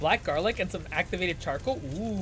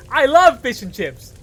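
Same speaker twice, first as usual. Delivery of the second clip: loud, high burst of enthusiasm; playful shout; 7 semitones higher, 12 dB louder, slower than usual. Pretend. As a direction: yell your love for it, much higher. Same words repeated as a yell.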